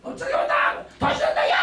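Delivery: high, ecstatic shouting cheer; laughing, high-pitched rally cry; excited shout. Acted. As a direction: whoop it up with joy. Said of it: "거기가 제가".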